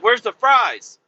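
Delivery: high, loud mock demand; demanding server. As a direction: imitate a server calling out for food. Server demands the missing fries.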